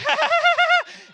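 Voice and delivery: very high, loud forced laugh; piercing put-on child's laugh; scripted fake laugh. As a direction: laugh on cue as if reading it off a script. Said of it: "What's funny? Why are you laughing?".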